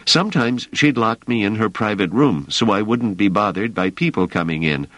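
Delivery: put-on a young man pleading his case; earnest young worker. Emeril voiced by narrator.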